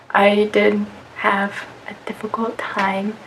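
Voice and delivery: usual speaking voice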